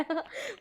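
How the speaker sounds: short giggle breaking out; a quick real laugh. Real, not acted. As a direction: burst into a little giggle. Narrator cracks up retelling.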